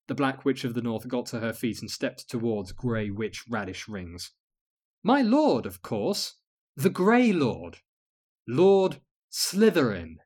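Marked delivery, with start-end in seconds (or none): 5.0-10.2 reverent. Acted. Guide reverently names her lord.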